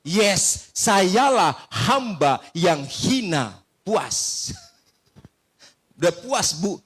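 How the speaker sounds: slow, loud, solemn declaration; proud confession. Retold or imitated. Pastor answering the lady, slow and hammed-up solemn. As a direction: declare it grandly, stretching each word.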